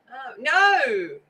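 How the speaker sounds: ordinary talk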